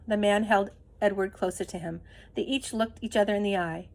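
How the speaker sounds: ordinary delivery, nothing marked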